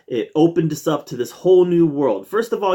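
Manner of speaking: ordinary talk, nothing marked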